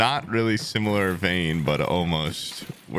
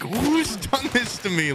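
mock dismay; 13 semitones higher, slower than usual. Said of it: "just being like".

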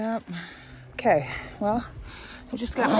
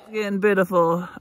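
ordinary talk

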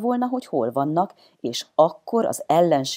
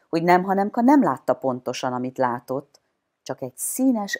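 ordinary delivery, nothing marked

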